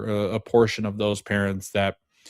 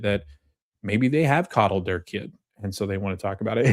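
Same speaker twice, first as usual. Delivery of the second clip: usual speaking voice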